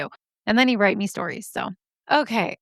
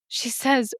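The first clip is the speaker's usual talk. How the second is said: normal talking voice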